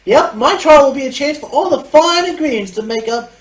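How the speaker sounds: high, put-on girlish voice; character voice. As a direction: pitch it up like a peppy trial captain. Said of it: "Actually, I'll do a southern voice".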